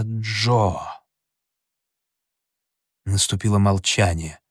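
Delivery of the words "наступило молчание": plain reading voice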